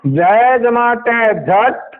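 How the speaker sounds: very slow, letter by letter; sounding out letters. Shown as a demonstration. Teacher sounds out letters before joining them. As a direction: sound each letter apart. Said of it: "इसमें वही पढ़ना है जोड़ के".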